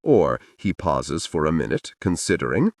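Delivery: ordinary delivery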